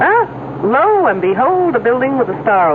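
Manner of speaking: delighted discovery. Acted. Driver spots the building she sought, delighted discovery. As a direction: exclaim as you suddenly spot it.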